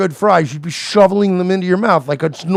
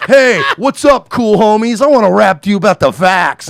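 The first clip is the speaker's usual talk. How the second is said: Bill de Blasio trying to be cool; 5 semitones higher, 6 dB louder than usual. Retold or imitated. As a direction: play a stiff politician pretending to be street. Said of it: "and he's like".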